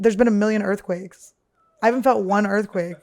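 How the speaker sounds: normal talking voice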